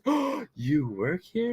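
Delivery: high, slow princess voice; Princess Aurora. Retold or imitated. Princess learning the diners are staff, terrible put-on accent. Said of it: "my accents are gonna be terrible".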